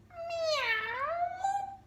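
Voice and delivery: very high sung meow; cat meow. Acted. The cat on the wall singing at night, high meow. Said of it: "sang the cat".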